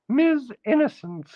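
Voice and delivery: high falsetto title. Acted.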